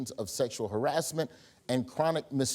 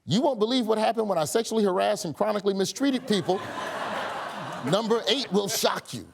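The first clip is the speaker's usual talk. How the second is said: hyped-up YouTube title read; clickbait video narrator; 6 semitones higher than usual. Pretend. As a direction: sell it like a clickbait thumbnail. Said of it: "which explains his new YouTube video".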